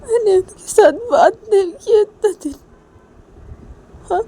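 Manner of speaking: high crying voice full of regret; a remorseful wife crying over her mistake; acted crying. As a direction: let the sob break through the words. Uma regrets, breaks down.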